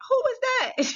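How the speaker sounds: high, startled question; a startled high cry of who is that. Retold or imitated. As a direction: ask it high and alarmed. Hears a strange voice.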